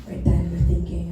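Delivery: hushed suspense whisper. Acted. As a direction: whisper it like a secret. Building suspense in a story.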